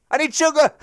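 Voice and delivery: loud, high burst of delight at the discovery; yelling at the screen, thrilled to need sugar; excited shout. Real, not acted. Gamer on stream yelling an excited shout. Suddenly realises the ingredient.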